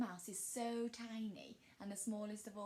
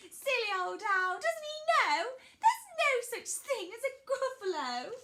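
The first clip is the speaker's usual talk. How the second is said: high, loud little mouse; mouse character; 12 semitones higher, 10 dB louder than usual. Acted. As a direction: speak up as a high-pitched, confident mouse. Mouse mocks the owl.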